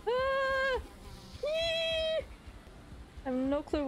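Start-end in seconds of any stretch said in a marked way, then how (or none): 0.1-2.2 startled squeal. Real, not acted. Startled by enemy.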